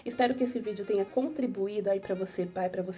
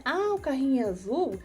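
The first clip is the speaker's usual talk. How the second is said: put-on playful mother to child; 4 semitones higher, 3 dB louder than usual. Shown as a demonstration. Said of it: "você sempre dá um tempo pra ela responder".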